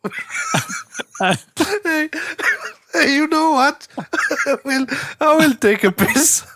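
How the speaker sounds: high giggly talk through laughter; can't stop laughing mid-sentence; laughing while talking. Real, not acted. Giddy laughter at 2 AM.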